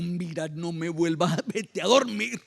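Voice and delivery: mildly irritated, hammed-up scolding; mock grumpy. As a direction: play an annoyed priest woken from sleep. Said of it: "no creo que la respuesta de Elí era tan, tan dulce".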